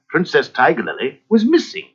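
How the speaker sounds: plain reading voice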